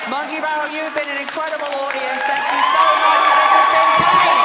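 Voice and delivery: loud, high thanks to the crowd; a big, bright end-of-show thank-you; loud sign-off. Real, not acted. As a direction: shout the goodbye over the applause.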